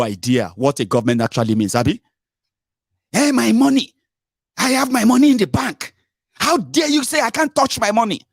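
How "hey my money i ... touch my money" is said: high indignant citizen voice, much higher; outraged "how dare you", pitched up; mock outrage. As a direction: play a protesting account holder.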